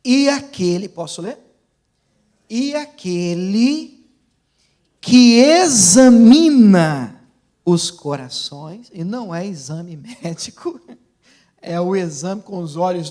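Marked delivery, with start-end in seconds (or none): 0.0-0.9 loud, higher opening of a reading; declamatory Bible reading; proclaiming scripture. Real, not acted. Begins reading scripture aloud. 2.5-8.9 very slow, declamatory Bible reading; slow, forceful pulpit proclamation; proclaiming scripture. Real, not acted.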